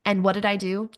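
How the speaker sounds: ordinary talk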